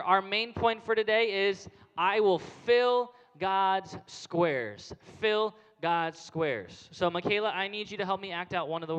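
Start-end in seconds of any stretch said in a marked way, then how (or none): 2.0-6.9 each word drawn out slowly; slow stressed motto. Shown as a demonstration. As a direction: spell the point out word by word. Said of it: "we're gonna do a motion together".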